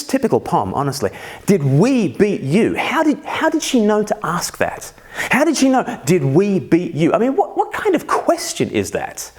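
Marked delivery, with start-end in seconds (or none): 1.4-2.7 slow, punched-out mock outrage; mock indignant repeat. Dad appalled by daughter's cheek, mock indignant. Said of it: "The cheek, the arrogance". 2.7-9.3 high-pitched, incredulous protest; mock outrage. Dad appalled by his daughter's question, incredulous.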